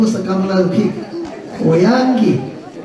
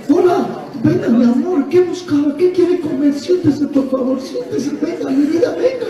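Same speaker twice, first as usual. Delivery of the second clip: higher, syrupy welcoming hostess voice; sweet waitress; 5 semitones higher than usual. Retold or imitated. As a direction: coax the diner in like a fawning waitress. Preacher imitates restaurant waitress welcoming. (mesera melosa con voz más aguda)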